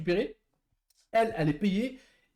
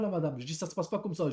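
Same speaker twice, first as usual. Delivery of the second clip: ordinary talk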